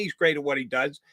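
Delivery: ordinary talk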